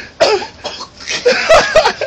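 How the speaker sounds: high-pitched cackle; high cackling laugh. Real, not acted. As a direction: cackle at him. Laughing at friend.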